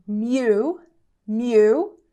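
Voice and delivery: "Mu" slow and isolated, /mu/; "Mu, mu" split off as a teaching syllable; first syllable alone. Shown as a demonstration. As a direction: sound out "Mu" on its own. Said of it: "Começando com a primeira parte".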